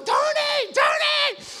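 very high, loud frantic yell of "Tony"; wife screaming name. Retold or imitated. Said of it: "mi esposa está gritando".

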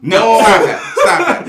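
high, loud laughing no; laughing protest. Real, not acted. Laughing protest at anime pick.

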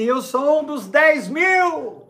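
high, slow proud boast; excited boast, drawn out. Pretend. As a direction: stretch it out, proud and thrilled. Imagined believer boasting. (orgulho empolgado, bem arrastado)

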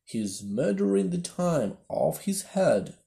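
loud furious bellow; bawling. A narrator's reading. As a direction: bawl it out at full force. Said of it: "bawled out".

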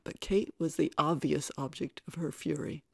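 usual speaking voice

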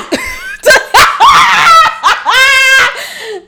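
high howling laugh; can't stop laughing out loud; big laugh. Real, not acted. Telling the meme story, loud howling laugh. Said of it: "I gotta laugh every time".

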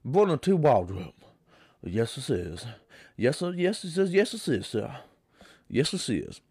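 lightly drawled Southern man introducing himself; a hint of the film character; old Southern accent. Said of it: "He's like".

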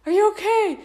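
high, loud panicked check-in; frantic are you okay; alarmed husband. Retold or imitated. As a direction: rush to her, worried. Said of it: "he's like".

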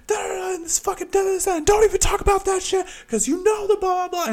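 very high reenacted rant; angry, near tears. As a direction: squeal out a retold furious protest, trailing into filler syllables. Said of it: "literally so angry, I'm almost in fucking tears".

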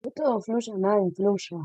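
strained voice while choking; squeezed out through a choke. Real, not acted. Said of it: "Sí, me ahogué".

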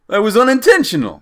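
high, loud cartoon Jersey accent. Lift driver from Jersey announces himself, hammed-up Jersey accent. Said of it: "Continue with your Jersey".

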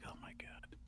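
whispered gasp; whispering. Real, not acted. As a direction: breathe it out in a whisper. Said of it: "I have to whisper".

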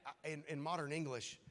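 normal talking voice

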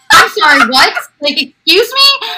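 baffled, rising pitch on the retort; mock disbelief. Onlooker hears the fan say Jackie Chan, mock disbelief. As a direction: act out being taken aback. Retold baffled reaction to the mistake.

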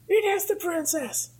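high-pitched excited exclamation; squeaky-high mock excitement; excited cartoon Luigi. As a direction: act out a thrilled discovery in falsetto. Acting out Luigi spotting the princess.

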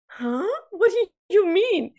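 imitating her own baffled reaction; surprised. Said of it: "And I was like".

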